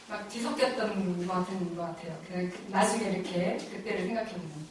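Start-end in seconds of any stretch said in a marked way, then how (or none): none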